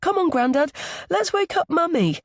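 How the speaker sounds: put-on high, eager child's voice; little boy. A narrator's reading. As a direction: urge grandad along like a small boy. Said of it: "He tugs his hand".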